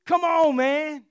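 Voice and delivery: high, loud pleading "come on, man"; loud exasperated plea to God; exasperated cry. Retold or imitated. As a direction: Job throws up his hands at God, loud and high. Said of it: "starts crying out to God".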